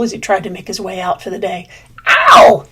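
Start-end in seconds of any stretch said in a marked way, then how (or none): 2.1-2.7 very high, loud yelp of pain; pained yell. Acted. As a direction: cry out, stuck tight. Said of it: "he yelled".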